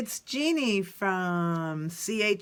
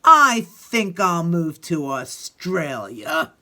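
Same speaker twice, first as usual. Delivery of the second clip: mild sulk; 4 semitones lower, 6 dB louder, slower than usual. Acted. You can tell he's pretty upset.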